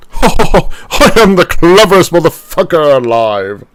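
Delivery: a posh villain with monocle and tea; pompous monocle gent. Retold or imitated. Host mocking himself as a monocled snob, haughty gloat. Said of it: "with a cup of tea and being like".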